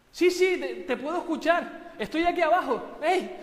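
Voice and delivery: high, loud eager call upward. Acted. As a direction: shout up to the voice above. Jack responde desde abajo. (grita hacia arriba, ansioso)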